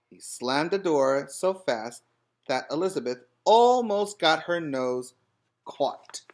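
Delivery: plain reading voice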